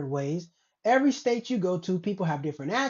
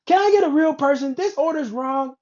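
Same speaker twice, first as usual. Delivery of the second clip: loud, higher exasperated complaint; angry customer yell; 6 semitones higher, 6 dB louder than usual. Acted. Customer demanding a real person over a wrong order, yelling. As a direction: yell it like a customer at the end of their patience.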